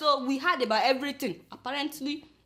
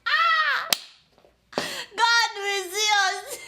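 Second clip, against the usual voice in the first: high, loud, mock wail; 10 semitones higher, 5 dB louder, slower than usual. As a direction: wail it the First Lady's way. Vlogger mimics the wail.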